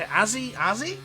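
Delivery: Northern English h-dropped "Azzy", rising like a question; Northern English accent. Shown as a demonstration. Northern commentator showing his accent, Northern English dropped-h "Azzy". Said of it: "This is where my accent can come strong".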